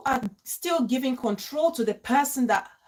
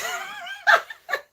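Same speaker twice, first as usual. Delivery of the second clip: high, shrill burst of laughter; shrieking laugh. Real, not acted. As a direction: crack up at your own line. Laughing at her own joke.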